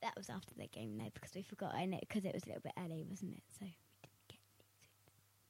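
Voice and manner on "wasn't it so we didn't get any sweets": hushed whisper, barely voiced. Real, not acted. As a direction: whisper it so no one hears. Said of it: "Why are you whispering?".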